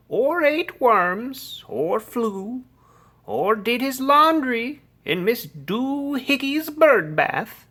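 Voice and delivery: high, loud listing of offences; scolding. A narrator's reading. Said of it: "she scolded".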